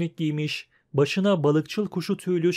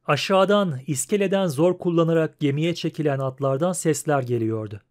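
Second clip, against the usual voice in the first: usual speaking voice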